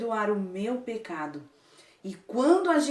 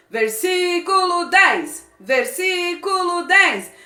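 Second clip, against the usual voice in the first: loud, slow military chant; like a soldier; 7 semitones higher, 12 dB louder, much slower than usual. Shown as a demonstration. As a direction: bark each phrase like a drill soldier. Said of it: "você vai falar igual um soldado". (alto, como um soldado)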